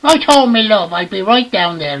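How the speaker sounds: high, loud cheery reply; acted loud obliging answer; calling back downstairs. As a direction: holler back down the stairs.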